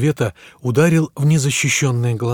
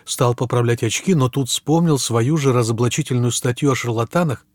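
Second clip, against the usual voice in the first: plain reading voice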